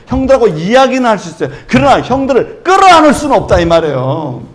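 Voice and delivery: loud, high, impassioned preaching; full-force preaching. Acted. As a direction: preach it out, building with fervour. (힘차게 외치는 설교)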